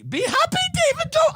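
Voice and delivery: high, loud call-out to David Dobrik; high emphatic call. Real, not acted. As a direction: shout it up high at him.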